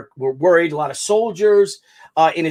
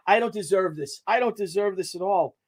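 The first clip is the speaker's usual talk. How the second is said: softer, humbled and near tears; choked up; 7 dB quieter than usual. Real, not acted. Moved by a flag flown in his honor, quietly choked up. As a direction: hold back tears while speaking. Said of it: "before I start bawling like a baby".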